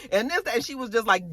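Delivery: ordinary talk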